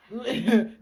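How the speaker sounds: short, loud laugh; burst of laughter. Real, not acted. Pastor laughing at the client's words, loud laugh. Laughing at his own retelling.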